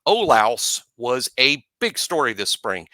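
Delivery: ordinary talk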